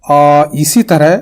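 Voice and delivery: normal talking voice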